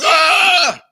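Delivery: high, loud mock shriek of shock; laughing fake scream at the screen; startled shout. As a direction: yelp it like a jump scare caught you. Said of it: "I was like".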